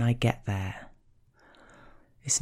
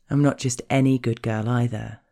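normal talking voice

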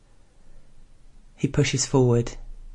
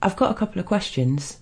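higher-pitched colleague venturing a question; lightly voiced higher character, venturing in; tentative colleague; 7 semitones higher than usual. A narrator's reading. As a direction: pipe up tentatively, pitched well up. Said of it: "Charlie ventures".

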